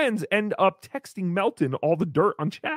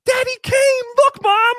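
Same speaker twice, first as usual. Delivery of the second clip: put-on much higher and louder, thrilled child spotting dad; kid's excited cry; 11 semitones higher, 7 dB louder than usual. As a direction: shout it like a kid whose dad showed up.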